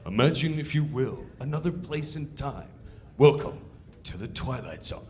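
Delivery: solemn, measured host intro with a low final line; ominous TV host opening; Twilight Zone narrator. Shown as a demonstration. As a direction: intone it like Rod Serling. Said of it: "the sketch is like".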